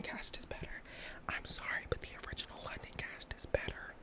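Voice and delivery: hushed whispered aside. Shown as a demonstration. Whispering a musical-theatre opinion to the camera. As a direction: whisper it like a guilty secret. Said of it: "whispered confession after shout outs".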